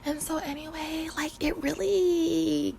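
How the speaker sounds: breathy whispered aside; hushed aside. Real, not acted. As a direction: drop to a whisper.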